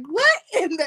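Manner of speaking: high, playful indignant disbelief; hammed-up shock at being scammed; laughing off the agency's con, outraged; mock outrage.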